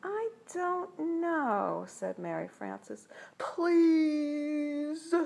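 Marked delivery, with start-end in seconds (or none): 0.0-1.8 high, drawn-out hesitation; coy reluctance. A narrator's reading. Girl feigns reluctance to tease boys. 3.4-5.1 put-on high, long-drawn kids' begging; pleading cry. A narrator's reading. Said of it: "they cried".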